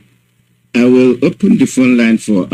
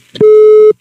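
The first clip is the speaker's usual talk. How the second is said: burst of laughter; 7 dB louder than usual. Real, not acted. Host laughing after guest's joke.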